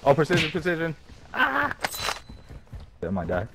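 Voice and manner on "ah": sharp high yelp of fright; startled yelp. Real, not acted. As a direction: jump and yelp in shock. Startled during firefight.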